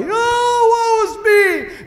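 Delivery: high, slow hammed-up crying; mock pity-party wail. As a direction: whine it like a self-pitying sob. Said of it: "pity party".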